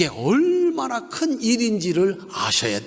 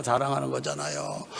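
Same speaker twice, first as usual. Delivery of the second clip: much softer and lower, a falling aside; soft echo; 6 semitones lower, 7 dB quieter than usual. Acted. Preacher echoing his own line to the congregation, softly. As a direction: drop the voice to repeat it quietly.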